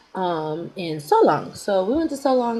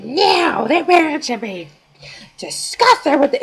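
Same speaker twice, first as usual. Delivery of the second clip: higher, louder mock indignation; indignant friend; 8 semitones higher, 5 dB louder, slower than usual. Friend scoffs at the video, mock indignant and higher. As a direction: play the shocked friend scoffing.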